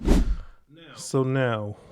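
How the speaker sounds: ordinary talk, nothing marked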